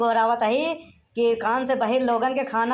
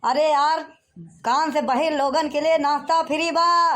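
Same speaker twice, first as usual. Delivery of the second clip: raised, higher voice for a deaf listener; exasperated shouting; 4 semitones higher than usual. Acted. Host repeating the free-snack offer to a deaf man, shouting. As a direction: shout it out so he finally hears. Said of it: "इतना चिल्लाए काहे बोला था?".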